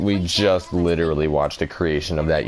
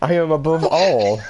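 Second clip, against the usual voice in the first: Zamasu impression; 9 semitones higher than usual. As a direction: proclaim yourself a perfect god. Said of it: "What was your quote?".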